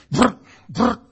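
loud high mock motor brr; wrench noise sputtering. Preacher mimicking the failing wrench, loud high brr.

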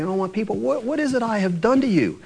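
high, gentle pleading as God; a loving God gently asking what he did wrong; loving plea. Retold or imitated. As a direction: tenderly plead with his people. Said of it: "saying it in a nice way".

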